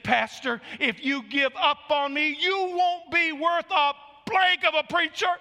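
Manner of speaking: slow, high, loud impassioned challenge; crying out. Retold or imitated. Raul challenging the pastor not to quit, crying out. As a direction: build to a drawn-out imitated cry. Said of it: "he cried out".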